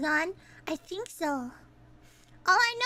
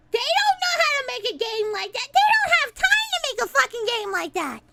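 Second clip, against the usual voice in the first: high-pitched, building indignant outburst; exasperated rant; 8 semitones higher, 3 dB louder than usual. Real, not acted. Streamer upset over who got the licence, indignant high rant. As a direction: climb higher and more worked up to the end.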